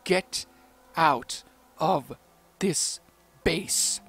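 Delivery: "Get... out of... this... base", each chunk punched out alone; word-by-word emphasis. A narrator's reading. Desti gripping Francis by the neck, "Get out of this base" punched word by word. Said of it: "punctuated by the camera getting closer to them with each word".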